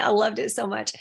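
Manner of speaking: ordinary delivery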